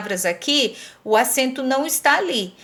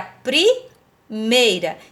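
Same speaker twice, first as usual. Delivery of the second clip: "primeira" broken after a rising "pri"; slow teaching emphasis on "primeira"; syllable-split emphasis; 2 semitones lower, much slower than usual. Shown as a demonstration.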